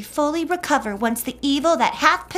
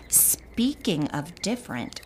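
hushed, leaning in to share gossip; gossipy whisper; 8 semitones lower, 10 dB quieter than usual. Acted. Gossip whispered to the sewing circle.

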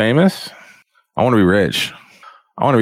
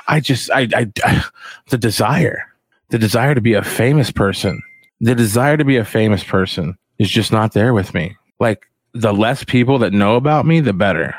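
usual speaking voice

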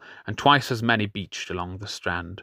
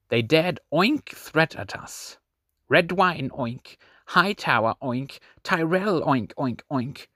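higher, slow sneering oink noises; mocking pig oinks; 7 semitones higher than usual. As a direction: ham up the squealing to mock them. Captain mocking enemies as pigs.

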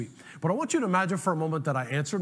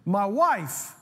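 higher, louder "My wife"; raised caller ID read; 4 semitones higher, 4 dB louder than usual. Shown as a demonstration. Preacher reads caller ID "My wife" higher and louder. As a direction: announce "My wife" high and loud. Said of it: "the caller I D said".

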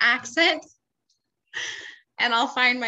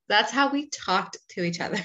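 ordinary talk, nothing marked

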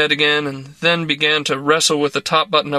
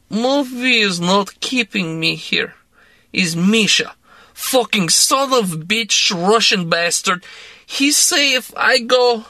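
put-on slow, higher Russian-accented bitter rant; 6 semitones higher, slower than usual. A narrator's reading. Woman venting about her controlling boss, in a bitter Russian accent. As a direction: spit the curses out, Russian-accented.

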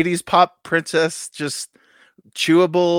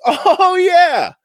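high excited cry of recognition; 7 dB louder than usual. Pretend. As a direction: blurt it out thrilled. Excited listener reaction acted.

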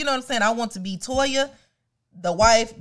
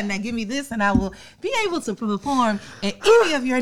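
ordinary talk, nothing marked

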